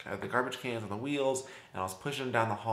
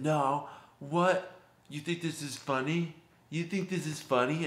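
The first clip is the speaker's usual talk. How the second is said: put-on higher, challenging drunk voice; a drunk squaring up to her; a hint of a menacing drunk; aggressive drunk; 5 semitones higher than usual. Drunk man gets aggressive.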